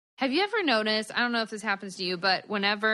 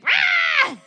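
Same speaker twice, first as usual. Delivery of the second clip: put-on sudden high loud baby squawk; imitated baby yell; 10 dB louder than usual. Mom imitating her baby's angry yell at her singing, high squawk. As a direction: squawk it like an annoyed infant.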